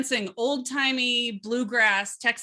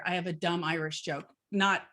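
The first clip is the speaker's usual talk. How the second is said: normal talking voice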